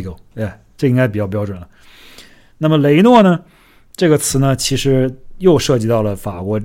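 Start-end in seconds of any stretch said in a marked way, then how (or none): none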